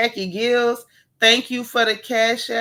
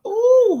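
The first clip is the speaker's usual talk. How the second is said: high excited ooh squeal, much louder; 9 dB louder than usual. Real, not acted. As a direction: let out a delighted high squeal. Reacting excitedly to a viewer.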